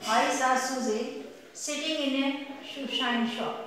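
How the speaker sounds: slow, careful, with each sound shaped; slow tongue twister demo. Shown as a demonstration. Teacher showing students a tongue twister, very slow. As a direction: spell out the hissing sounds slowly. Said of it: "now next is".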